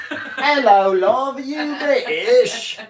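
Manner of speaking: high, drawn-out mock British accent; hammed-up British accent, stretched out for laughs; terrible British accent. Co-host teasing his British partner, bad British accent. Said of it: "we're sorry for the terrible accent".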